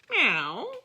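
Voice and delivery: mock meow. A narrator's reading. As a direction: meow like a kitty. Cat meows.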